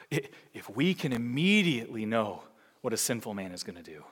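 usual speaking voice

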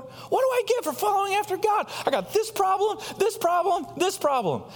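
mock self-pitying complaint, repeating this problem; complaining believer. Preacher voicing a believer grumbling at God, mock whiny. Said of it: "Have you ever felt similarly in your life before?".

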